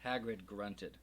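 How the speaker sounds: ordinary delivery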